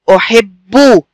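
"أحب" as "u-hib-bu", double b held; doubled b demonstration. Shown as a demonstration. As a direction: show the doubled b in "أحب" with a firm final u. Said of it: "عشان بيتنطق مرتين".

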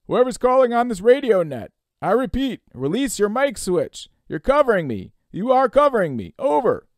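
loud, high voice over the radio; radio operator voice. Acted. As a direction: call firmly through noise and static. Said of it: "he heard a voice".

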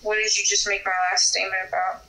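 usual speaking voice

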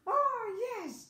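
high, loud cry of triumph; fox's delighted exclamation; gleeful cry. A narrator's reading. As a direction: crow it loudly, drawn out. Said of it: "cried the fox".

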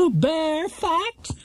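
very high giggling repeat; high laughing. Acted. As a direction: squeak it out laughing. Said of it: "in a rather high voice".

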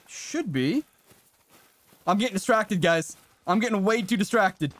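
ordinary delivery

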